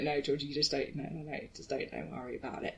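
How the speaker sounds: hushed dismissal. Retold or imitated. Friends in the pub brushing off her question, hushed. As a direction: hush her and wave it away.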